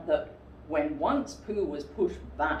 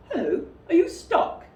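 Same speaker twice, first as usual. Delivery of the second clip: Rabbit voice; 11 semitones higher, 8 dB louder than usual. Acted.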